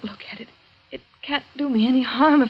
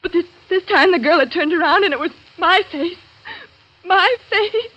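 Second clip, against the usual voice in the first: louder and higher on my face; horrified; 6 semitones higher, 5 dB louder than usual. Acted. Julia telling Laura her own face was there, horrified. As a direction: recoil at what she saw.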